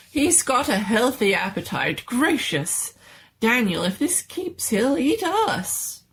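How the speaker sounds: high, cheery doctor, slowing at the end; delighted mad doctor. Acted.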